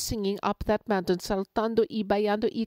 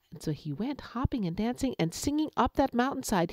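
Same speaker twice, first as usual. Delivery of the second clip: plain reading voice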